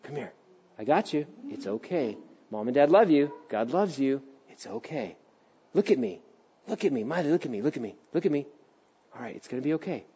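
soothing father. Retold or imitated. Father soothing his sobbing daughter, hushed and tender. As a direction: murmur gently to calm a crying child. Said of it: "It's like".

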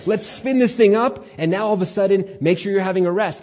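high, loud, eager scheming; lightly hammed-up officious schemer; mock rule-maker. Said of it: "man took it and said".

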